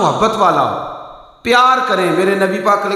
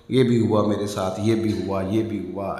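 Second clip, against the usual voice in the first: softer, lower, a touch of imitated weepy listing; tearful complaining; 9 semitones lower, 7 dB quieter than usual. Daughter crying while telling of her marriage fights, softer and lower. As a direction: mildly mimic a tearful daughter listing hurts. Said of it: "رو رو کے بتائے".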